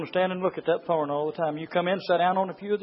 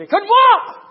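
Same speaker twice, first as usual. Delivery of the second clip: sudden high, loud pulpit cry; preaching shout; 12 dB louder, slower than usual. Real, not acted. Preacher bursts into a shout, then repeats.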